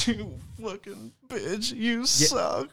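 mock-whine it like a crybaby. Comedian showing how he whines when angry, mock crybaby. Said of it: "I'm like a crybaby".

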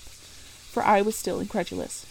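ordinary delivery, nothing marked

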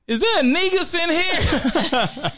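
very high, slow mock-timid voice; squeaky-high imitation of her calling out. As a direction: imitate a nervous barista, laughter creeping in.

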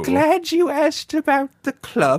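high, put-on English lady accent; subdued English lady. Chatting in the ladies' club, high mock English accent. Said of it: "subdued English lady".

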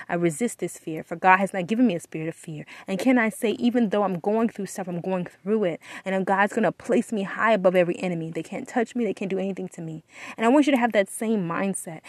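usual speaking voice

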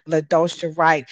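usual speaking voice

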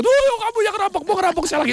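high-pitched startled outcry; acted alarm. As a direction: victim panicking at the robber's return. Startled victim confronts returning robber. (pura-pura panik, suara melengking tinggi)

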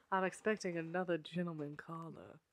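languid, genteel Southern lady; Southern belle. Pretend.

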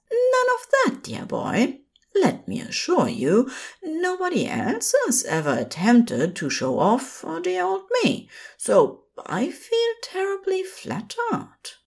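gently scold, then preen; fussy Aziraphale. A narrator's reading. Aziraphale brushing off Crowley's self-criticism, high and prim.

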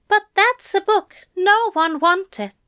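Aziraphale voice. A narrator's reading. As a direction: lift the voice for the fussy angel. Aziraphale, rueful.

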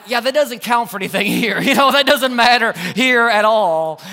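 a rueful laugh through the words. Real, not acted. Laughing at the VIP irony.

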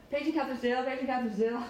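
airport announcer. Acted. Airport calling a missing passenger, announcer voice. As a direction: mimic a tannoy page, said twice. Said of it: "The airport doesn't do this well".

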